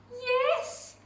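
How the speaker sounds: high, glad cry; eager yes. Acted. Witch welcoming the green bird, a high cried yes. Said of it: "cried the witch".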